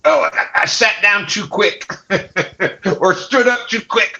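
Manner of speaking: put-on high pained old-man groan. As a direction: groan like creaky old bones. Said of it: "you're like".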